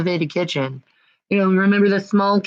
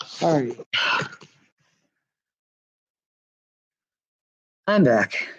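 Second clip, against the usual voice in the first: out of breath; 4 semitones lower, 3 dB quieter than usual. Real, not acted. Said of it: "You see how I'm out of breath?".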